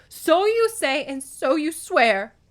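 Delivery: high, loud, harsh retort, slowing; grating. A narrator's reading. Demeter throws Zeus's oath back, grating. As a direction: grate it out like chalk on slate. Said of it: "grated like chalk on slate".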